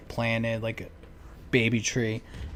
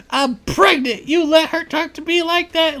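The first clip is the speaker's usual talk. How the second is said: mocking shrill woman; 6 dB louder than usual. Retelling neighbors' yard fight.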